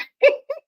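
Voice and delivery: loud high cackle; shrieking laugh. Real, not acted. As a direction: let a high laugh burst out. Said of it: "I'm only laughing".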